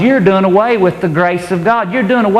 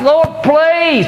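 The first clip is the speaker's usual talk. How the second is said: pleading whiner; 10 semitones higher than usual. Acted. Believer begging God for house.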